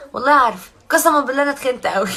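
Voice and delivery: loud, emphatic swearing it's true; loud insisting. Real, not acted. Loudly admitting to fans she really got fat. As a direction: swear it loudly, half laughing.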